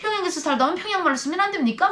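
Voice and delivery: high, fast retort in Pyongyang dialect. Retold or imitated. Defiant prisoner answering the guard in Pyongyang dialect. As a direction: snap back in Pyongyang dialect. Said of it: "평양말을 막 쓰니까".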